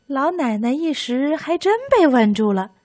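ordinary delivery

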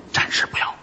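hushed customer's refusal; furtive, voiceless reply; secretive whisper. Pretend. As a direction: decline in a bare whisper. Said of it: "小声嘀咕着说".

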